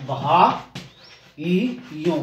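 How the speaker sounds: बहा ई यो as "bhaa, ee, yon", pauses between; teacher sounding out बहा ई यो; syllable-by-syllable demo. Shown as a demonstration. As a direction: give each syllable of बहा ई यो alone. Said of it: "वायु ऐसे आएगा".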